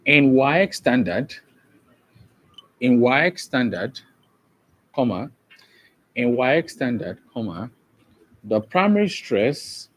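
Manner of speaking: measured, repeated phrases for note-taking; slow dictation. Real, not acted. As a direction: dictate it bit by bit with long gaps. Dictates notes to the class.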